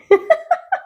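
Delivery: high squeaky giggle; real self-amused giggle, very high; laughing at herself. Real, not acted. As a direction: burst into a real high laugh at your own stumble. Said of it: "I'm laughing at myself".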